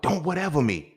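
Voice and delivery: high, loud sisterly rebuke; scolding big sister. Retold or imitated. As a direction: snap at him like a big sister.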